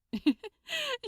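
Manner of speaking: short high giggle; amused giggle. A narrator's reading. Aziraphale amused by Crowley's question, short high giggle. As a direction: giggle at his cluelessness.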